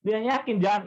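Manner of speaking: ordinary talk, nothing marked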